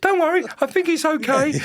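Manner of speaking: very high retold reassurance; smooth it over in a squeaky-high voice; mock-meek, pitched far up; high placating reply.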